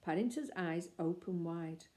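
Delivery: usual speaking voice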